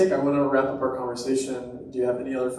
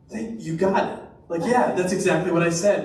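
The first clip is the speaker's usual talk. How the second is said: delighted agreement; 6 semitones higher, 5 dB louder than usual. Retold or imitated. Acting out woman's delighted agreement.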